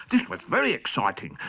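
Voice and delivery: plain reading voice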